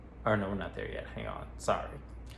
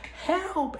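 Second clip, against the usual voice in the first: cry for help. Retold or imitated. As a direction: scream it out for help. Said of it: "and she's like".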